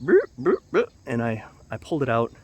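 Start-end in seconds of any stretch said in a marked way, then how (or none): 0.0-0.9 playful high boop noises, first one louder; mock boop sounds. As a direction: pop out cartoon boops as the arm comes free. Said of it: "I just went".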